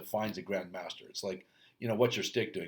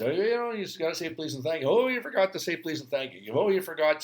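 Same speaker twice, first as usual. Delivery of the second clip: higher, sing-song reminding parent; nagging parent; 6 semitones higher than usual. Retold or imitated. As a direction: chide the kid gently in a lilting voice. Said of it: "And then you'd say to your kids".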